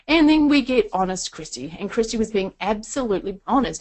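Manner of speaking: ordinary talk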